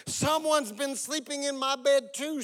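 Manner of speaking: high storybook bear voice; Mama Bear. A narrator's reading. Mother bear finds her bed slept in, high voice. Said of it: "said Mama Bear".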